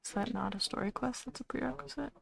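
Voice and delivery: ordinary delivery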